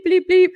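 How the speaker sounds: high, loud spoken bleeps standing in for swearing; mock censor bleeps. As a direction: say bleep bleep like a censor tone covering a curse. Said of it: "I don't wanna bleep".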